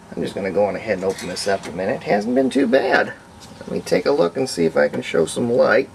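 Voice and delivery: normal talking voice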